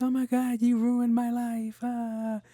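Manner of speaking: put-on whiny kid. Kids wailing at parents for leaving, high and drawn out. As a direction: wail it like a kid melting down. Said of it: "kids would be like".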